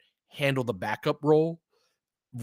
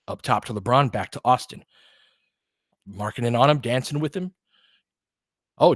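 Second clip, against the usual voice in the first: running game call, steady and brisk; live play-by-play. Acted. Streaming the Lakers game to his viewers, live play-by-play. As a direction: call each possession as it happens.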